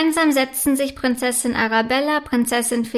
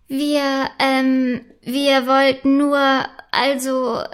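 halting, embarrassed hesitation; stammering; much slower than usual. A narrator's reading. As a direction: stall between words, searching for an excuse. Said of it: "stammelt Prinzessin Felicitas". (verlegenes Stammeln)

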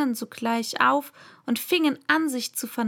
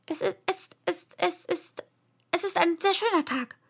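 high, hesitant stammer; shy, timid; 8 semitones higher than usual. A narrator's reading. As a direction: start haltingly, then get it out timidly. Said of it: "sagte eine schüchterne Stimme".

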